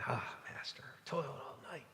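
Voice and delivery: tired, breathless near-whisper; exhausted fisherman, soft and spent; weary whisper. Retold or imitated. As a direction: sigh it out like a worn-out fisherman. Weary Peter answers Jesus.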